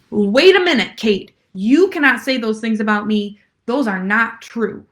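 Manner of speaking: loud, indignant protest; indignant pushback. Pretend. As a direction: stand up for yourself, loud. Client pushing back at trainer.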